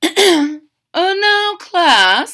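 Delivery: high, loud prim teacher; a fussy bird teacher calling the class; Miss Ibis voice. Acted. Miss Ibis calls her crocodile class to order, high prim teacher.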